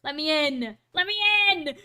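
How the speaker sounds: acted plea building to a yell; mock screaming cry. Streamer voicing a child at a burning orphanage, mock desperate scream. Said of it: "he's, like, screaming and crying".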